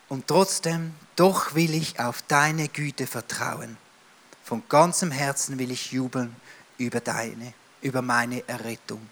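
soft, slow, trusting psalm reading; quiet trust. Acted. David turning to God in trust after his lament, softly. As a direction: read it as a hushed promise of trust.

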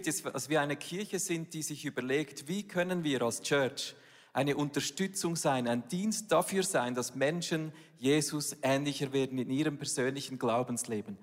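ordinary delivery

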